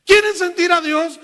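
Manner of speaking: loud, high pulpit cry; preacher calling out. Retold or imitated. Preacher calling on the crowd to feel God, loud. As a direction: shout it to the whole crowd.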